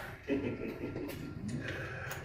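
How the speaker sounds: high, eerie cackle after the toast; drunken laughter. A narrator's reading. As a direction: cackle like a reveller in the dark.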